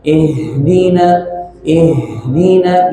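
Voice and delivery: "Ihdina" slowly, with breath flowing through the h. Shown as a demonstration. Teacher modelling the big ha, flowing breath. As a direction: let an airy h run on in "Ihdina". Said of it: "Alirkan nafasnya".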